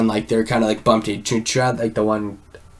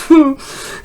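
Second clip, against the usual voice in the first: short high-pitched mock squeal; imitated startled yelp; 4 dB louder than usual. Little guy just scared by Arthur, high mock yelp. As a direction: let out a brief high yelp.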